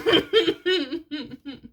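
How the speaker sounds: high, rippling giggle; giggling. Real, not acted. Reader amused by her own aside, high giggling. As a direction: giggle helplessly, trailing off.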